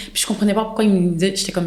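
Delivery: ordinary delivery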